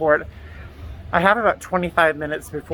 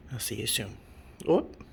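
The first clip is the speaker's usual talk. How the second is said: ordinary talk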